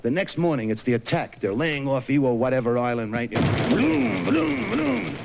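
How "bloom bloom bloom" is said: high booming horn call; mock ship horn. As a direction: imitate a ship's horn, hammed up.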